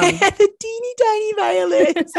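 high, slow, laughing squeak; mock it in a tiny high voice through laughter; teeny tiny voice. Joking about tiny violins, laughing.

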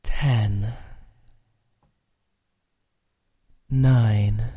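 slow, hushed count from ten down; slow soothing countdown. Acted. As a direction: count them down gently into calm. Counting listener down into relaxation.

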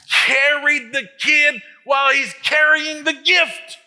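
high, loud, strained climax; preaching peak. Real, not acted. Preacher to mothers, crying out loud. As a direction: shout it to the rafters.

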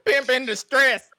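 high, loud, slow laughing taunt; hammed-up pimp voice. Joking about Pearce, high loud hammed-up pimp taunt. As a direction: ham it up like a cartoon pimp.

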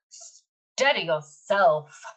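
ordinary delivery

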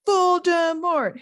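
high, drawn-out acted bellow; long stretched yell. A narrator's reading. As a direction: call the word out slowly and defiantly. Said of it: "Harry bellowed".

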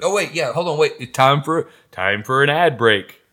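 loud, excited interruption; announcing an ad break. Real, not acted. Co-host halting the shout-out for an ad, loud announcement. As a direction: cut in loudly to announce the break.

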